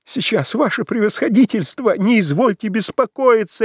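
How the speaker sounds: high, eager exclamation; host eagerly reassuring the general; energetic cry. A narrator's reading. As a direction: jump to it with zeal. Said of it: "энергически вскрикнул Псевдонимов".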